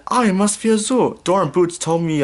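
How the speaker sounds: lightly voiced cheerful engine; a touch of Thomas. A narrator's reading. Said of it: "Thomas said".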